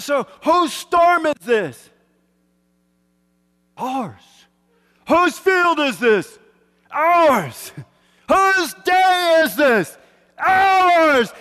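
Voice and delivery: loud high chant, slow calls with one-word answers; shouted call and response. Retold or imitated. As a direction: lead the team in a roaring chant.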